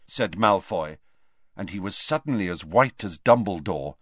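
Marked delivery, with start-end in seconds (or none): none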